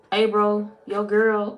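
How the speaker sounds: loud, punchy "Hey, bro" like a homeboy. Shown as a demonstration. Showing how her brothers greet each other, loud bro call. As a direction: bark it out like one of her brothers. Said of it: "I gotta say it like that".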